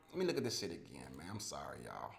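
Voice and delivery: low, very soft apology to the listeners; quiet aside. Real, not acted. Host apologizing while he looks at his picks, hushed aside. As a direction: drop right down, as if talking to yourself.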